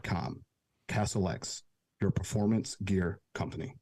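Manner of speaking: measured, formal commercial delivery; careful english. Shown as a demonstration. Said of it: "professional, non-native voice".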